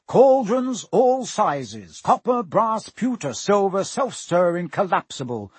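high, loud, slow hawker voice; shop sign pitch. Acted. Sign over the cauldrons, barker's call. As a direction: announce it like a barker.

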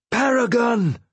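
high, ringing bellow; bellowed name. Acted. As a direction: bellow the name across the deck. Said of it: "Boyo bellowed the name".

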